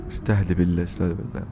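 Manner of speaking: hushed, gentle calming of a crying woman; barely above a hush, soothing; soft soothing. Acted. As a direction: quietly talk her down.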